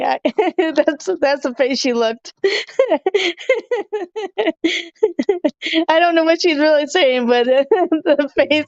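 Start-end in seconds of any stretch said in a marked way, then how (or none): 2.4-5.8 long high cackle of delight; high laugh. Real, not acted. Recalling her friend's face on camera, high laugh.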